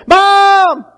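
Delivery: high, loud shout across the store; lost child yelling for mom. Pretend. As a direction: yell for your mom over the aisles.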